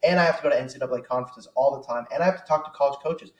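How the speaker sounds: usual speaking voice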